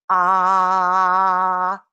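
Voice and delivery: steady, held ah from the throat; throat-driven ah. Shown as a demonstration. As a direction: sound a long ah with no belly support. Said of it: "is entirely from the throat".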